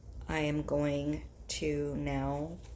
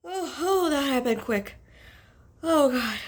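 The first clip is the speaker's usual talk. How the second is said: excited; 10 semitones higher, 7 dB louder than usual. Real, not acted. Reacting after a quick sneeze.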